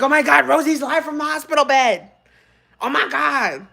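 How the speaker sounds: high-pitched re-enacted thrill; mock excited gasping. Streamer re-enacts seeing Rosie live from hospital, mock excited.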